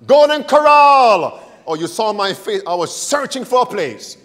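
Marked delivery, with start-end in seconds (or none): 0.1-1.4 shouting it out happily; loud excited answer. Retold or imitated. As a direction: blurt it out with glee.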